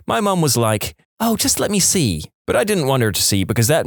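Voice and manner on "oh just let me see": higher, coaxing mum voice. Retold or imitated. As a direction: coax like an impatient mother. Mum at fitting room.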